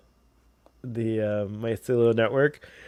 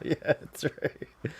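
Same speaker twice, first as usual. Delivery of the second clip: breathy laugh through the words; soft laughing agreement; 9 dB quieter, much slower than usual. Real, not acted. Laughs at the remembered scene.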